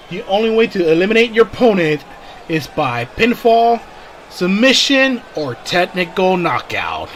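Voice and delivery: booming wrestling announcer voice; ring announcer. Acted. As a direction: announce it to the arena. Announces match rules.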